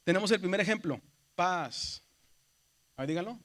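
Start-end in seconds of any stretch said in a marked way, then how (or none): 1.4-2.0 "paz" con la A estirada en arco; alargado, sube y baja. Shown as a demonstration. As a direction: alarga la A de "paz", súbela y bájala. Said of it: "se alarga un poco y sube y baja". ("paz" en acento circunflejo, vocal larga que sube y cae)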